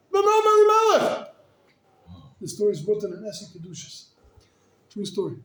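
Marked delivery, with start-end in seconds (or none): 0.1-1.3 high, loud awe; preacher marvelling at the rebbe, high and loud; awed exclamation. Real, not acted. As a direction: proclaim the name in wonder.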